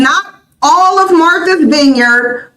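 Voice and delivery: ordinary talk, nothing marked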